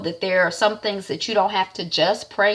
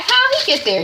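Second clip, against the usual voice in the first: far higher, astonished question; amazed sister; 5 dB louder than usual. Retold or imitated. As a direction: pitch it up in disbelief.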